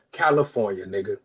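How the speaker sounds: ordinary talk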